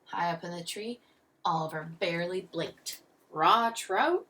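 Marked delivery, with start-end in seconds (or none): 3.3-4.3 louder, doubtful rising question; sighing. A narrator's reading. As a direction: question it with weary distaste. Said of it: "Mister Sims sighed".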